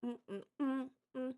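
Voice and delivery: muffled chewing hums; chewing sounds. Real, not acted. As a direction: hum while crunching. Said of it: "not just crunching all up in your ears".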